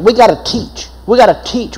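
ordinary delivery

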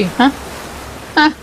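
ordinary delivery

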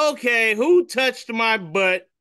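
high, slow mock Rogue; slow, high, teasing question; lightly voiced Rogue in a comic.

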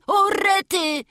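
high, acted boyish wail; wailing outcry. Boy up high discovers his loss, wailing. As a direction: cry out in sudden dismay. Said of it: "stoi ano jeszcze na górze i beczy".

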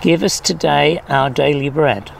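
ordinary talk

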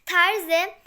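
ordinary delivery

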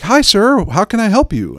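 high, bright customer-service voice; mock polite cashier greeting; chipper teen worker. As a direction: chirp it like an eager teen cashier. Imitating chipper bagel shop teen.

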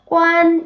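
관 held high, level and loud; high first tone. Shown as a demonstration. Teacher showing first tone, 관 high and level. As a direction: sustain 관 on one high note. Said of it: "일 성".

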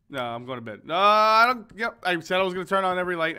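ordinary delivery

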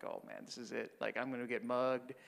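low, hushed aside to himself; worried inner voice. Retold or imitated. Priest thinking he is about to be mugged, hushed dread. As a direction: mutter it as a resigned worried thought.

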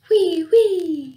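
high, loud whistling whee; high loud windy whee; wind whee. A narrator's reading. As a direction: shriek it like the wind. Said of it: "shrieks the wind".

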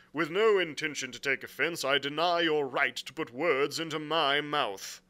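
loud, firm, formal captain; blunt sea captain. Acted. Captain objecting to words put in his mouth, firmly. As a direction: rebut it with stiff courtesy. Captain's dialogue beside narration.

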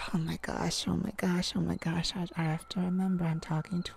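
soft whispered oh my gosh; embarrassed whisper. Acted. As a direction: hush it to yourself, mortified.

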